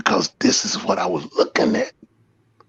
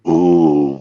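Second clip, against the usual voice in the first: ordinary talk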